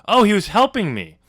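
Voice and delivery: a hammy "oops"; Skylar realizing. Retold or imitated. Skylar regretting the door, loud and high mock realization.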